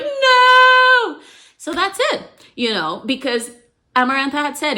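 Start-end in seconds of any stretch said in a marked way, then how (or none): 0.0-1.2 high, loud scream of rage; furious shout. Retold or imitated. Queen screaming at the heroine who solved the riddle, loud and high. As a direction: scream it out as the villain loses. Villain hears the answer.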